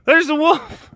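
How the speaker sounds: put-on high-pitched boy raising the alarm; hammed-up panicked crying wolf; mock yelling. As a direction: plead with the townspeople to come. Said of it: "the boy yells again".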